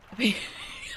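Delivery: breathy burst of laughter; real laugh. Real, not acted. Laughing at own childhood memory, real laugh. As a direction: crack up mid-story. Cracks up telling own story.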